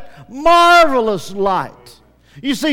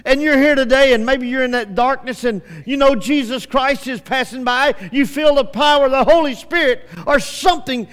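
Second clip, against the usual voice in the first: normal talking voice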